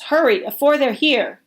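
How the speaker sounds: urgent push. Acted. Momo Bay pushes boys into cellar.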